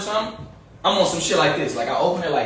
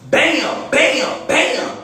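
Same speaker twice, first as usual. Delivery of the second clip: imitated banging; 7 semitones higher, much slower than usual. Imitating shots on the door.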